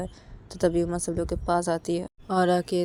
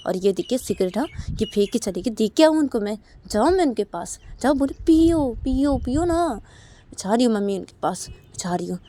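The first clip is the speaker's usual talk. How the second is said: angry quote; 4 semitones higher, 6 dB louder than usual. Acted. Mother voiced angrily in story.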